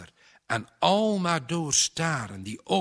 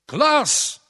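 booming shout, much higher and louder; bulderend; 12 dB louder than usual. A narrator's reading. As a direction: roar the name through the whole house. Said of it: "De stem buldert door het huis". (bulderende schreeuw)

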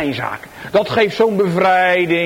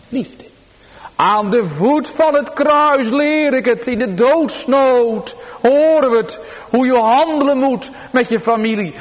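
raised, louder preaching voice; fervent preaching; 7 semitones higher, 3 dB louder than usual. Acted. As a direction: proclaim it to the congregation. Preaching at full force. (vurig preken)

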